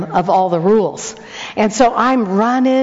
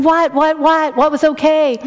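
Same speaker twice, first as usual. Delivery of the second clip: baffled; 6 semitones higher than usual. Retold or imitated. Grandma baffled.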